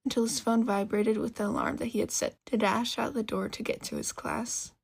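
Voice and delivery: plain reading voice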